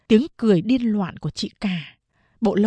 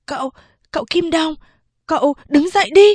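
put-on fast, high, louder boy's push; urging on; 7 semitones higher, 6 dB louder, faster than usual. Servant boy on master's shoulders urging him up, fast and loud. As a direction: strain and hurry him to stand. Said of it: "giục tiếp".

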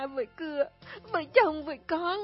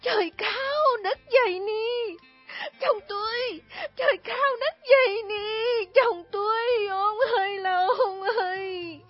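very high, loud, slow crying lament; a widow's anguished wail, much higher and louder; wailing to heaven; 10 semitones higher, 6 dB louder, slower than usual. Acted. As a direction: cry out to the sky in grief. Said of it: "ngẩng mặt lên trời".

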